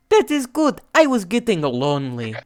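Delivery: put-on Russian accent, starting high and sinking. Russia welcoming the world meeting, Russian accent. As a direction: open high in a Russian accent, then settle. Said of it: "your accent for Russia is amazing".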